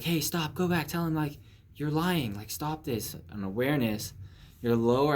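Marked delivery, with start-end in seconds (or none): 0.0-3.1 high, pressing conscience; urgent inner voice. Pretend. Higher self urging you to confess, high and pressing. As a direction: pitch it up and push them to go back. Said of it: "your higher self will be like".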